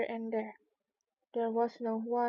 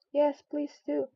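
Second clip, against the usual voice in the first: higher voice of the crowd; chorus pleading; 4 semitones higher, 3 dB louder, slower than usual. A narrator's reading. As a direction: plead together as one. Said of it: "the others all joined in chorus".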